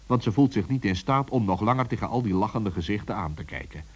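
plain reading voice

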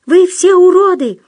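very high, loud squeal; terrified falsetto parroting. A narrator's reading. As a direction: shriek it back in falsetto. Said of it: "повторил Дутов фальцетом".

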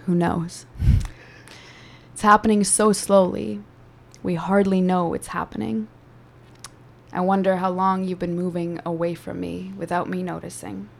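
measured, intimate poetry performance; spoken word poem. Acted. Poem continues after interjection.

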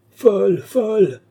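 loud, high, drawn-out wail; crying out. A narrator's reading. Lorenza ignores Balsamo's soothing, crying out. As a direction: stretch each cry, high and loud. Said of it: "criait Lorenza sans écouter Balsamo".

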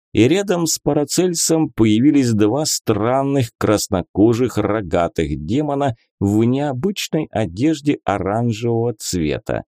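ordinary delivery, nothing marked

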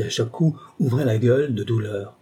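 usual speaking voice

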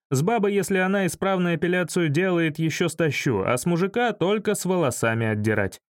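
usual speaking voice

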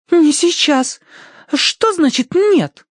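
put-on very high, girlish indignation; indignant. A narrator's reading. Tossie challenging the butler's verdict, indignant. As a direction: demand it in a squeaky, affronted voice.

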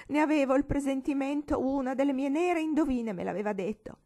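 keen over the dead daughter, overwrought; hysterical lament. Acted. Old lady lamenting the dead Clementina, overwrought loud lament.

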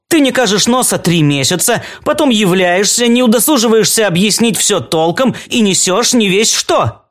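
high-pitched scolding tirade; angry. A narrator's reading. Alice berates Claude after three months away, angry. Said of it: "сердито глядя на него".

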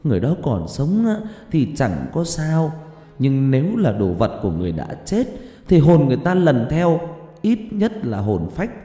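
angry scolding. A narrator's reading. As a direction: berate them like a frightened, angry mother. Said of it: "hét ầm lên".

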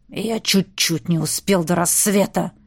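low beastly growl. A narrator's reading. Beast duke growls his excuse to the heroine, low. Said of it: "рыкнул герцог".

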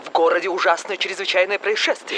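brisk announcer read; news bulletin. Acted. TV newsreader announces the cucumber epidemic.

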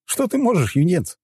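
high-pitched teasing jeer; amused, derisive question; mocking smirk. A narrator's reading. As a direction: taunt a helpless youngster. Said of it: "усмехнулся я".

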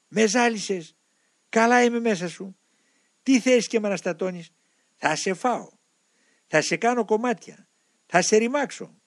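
high-pitched demon hurling threats; threatening demon. A narrator's reading. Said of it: "να απειλεί και να υβρίσει". (απειλητική, ψηλή φωνή δαίμονα)

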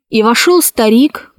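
usual speaking voice

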